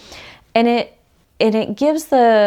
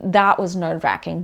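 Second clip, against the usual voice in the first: ordinary talk, nothing marked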